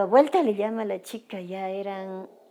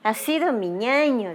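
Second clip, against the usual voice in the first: imitated wail, a little higher and louder; raised, half-strength mock cry; retold cry; 4 semitones higher, 6 dB louder than usual. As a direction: retell a daughter crying out for her brother. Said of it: "mi hija sale gritando".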